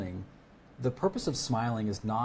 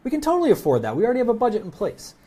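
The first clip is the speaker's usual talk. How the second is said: much higher, bright eager yes; upbeat, pitched-up customer voice; eager prospect; 11 semitones higher, 4 dB louder than usual. Retold or imitated. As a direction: play the thrilled buyer who has budget. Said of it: "they might say".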